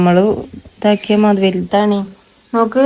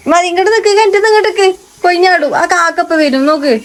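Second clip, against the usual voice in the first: high urgent warning; 9 semitones higher, faster than usual. Real, not acted. Mother warning child from well.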